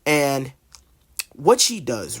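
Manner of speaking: ordinary delivery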